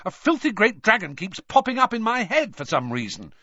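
high-pitched, exasperated complaint; angry. A narrator's reading. Harry complaining of dragons in his head, angrily. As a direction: vent frustration, pitch riding high. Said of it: "said Harry angrily".

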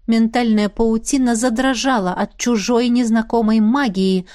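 ordinary delivery

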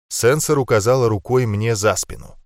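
ordinary delivery, nothing marked

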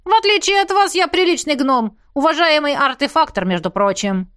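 high-pitched affronted gnome; indignant. A narrator's reading. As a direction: protest your respectability in a huff. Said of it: "возмутился гном".

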